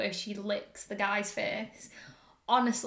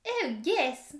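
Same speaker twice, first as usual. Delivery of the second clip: higher, terrible mock Russian doing English; bad Russian-English accent; 6 semitones higher than usual. Co-host dared to do the accent, bad Russian-English accent. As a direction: badly attempt a Russian putting on English. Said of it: "Russian person doing an English accent".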